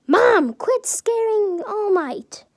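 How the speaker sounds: high, loud, stretched-out shout; exasperated yell. A narrator's reading. Izuku tells mom off, yelling out. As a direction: yell it high, dragging the words out. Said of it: "He yells out".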